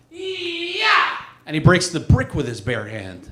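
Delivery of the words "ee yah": high, sharp martial arts kiai; imitating a karate master's battle cry; karate shout. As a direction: bark it out as you chop a board. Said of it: "and he goes".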